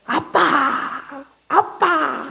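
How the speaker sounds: high, slow, stretched-out call of Daddy; tender call. Shown as a demonstration. A preacher showing how to call God Daddy, high and tender. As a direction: call Daddy like a longing child. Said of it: "tender cry to Father".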